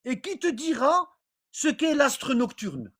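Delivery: normal talking voice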